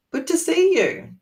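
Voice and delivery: bright greeting. Shown as a demonstration. Translating greeting, bright high.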